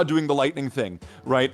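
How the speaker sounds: ordinary talk, nothing marked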